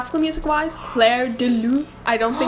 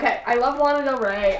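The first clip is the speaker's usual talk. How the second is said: ordinary talk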